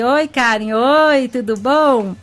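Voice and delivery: normal talking voice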